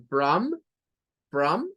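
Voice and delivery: from with an au vowel; from, a mixed a and u; teacher model of from; au sound. Shown as a demonstration. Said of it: "con el sonido au".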